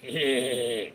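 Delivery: low, soft cartoon snicker; Beavis laugh. Streamer answering a chat request for Beavis, snickering laugh. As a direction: give a pulsing cartoon-teen snicker.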